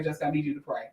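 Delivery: a hint of casual Frank; lightly voiced Frank. Frank asking Jessica to pray, quiet. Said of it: "Frank was like".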